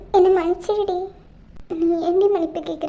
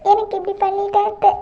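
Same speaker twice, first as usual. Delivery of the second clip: ordinary talk, nothing marked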